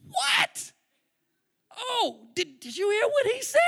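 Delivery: very high, loud shriek of disbelief; hammed-up amazed outburst; astonished. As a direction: react as if floored by what you heard. Acts out amazement at the sermon.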